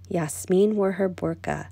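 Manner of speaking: ordinary delivery, nothing marked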